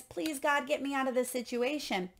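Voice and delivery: ordinary talk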